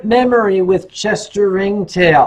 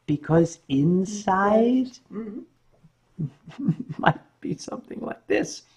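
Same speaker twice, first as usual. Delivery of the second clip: hushed punchline; 8 dB quieter than usual. Real, not acted. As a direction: let it sink to a hush with a little laugh. Punchline after loud warning.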